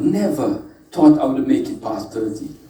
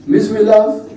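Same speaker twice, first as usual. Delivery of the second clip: higher, "love" left hanging for an answer; audience prompt; 7 semitones higher than usual. Real, not acted. Speaker has the audience finish the saying, raised call-and-response prompt. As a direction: lift "Misery love" and stop short, unfinished.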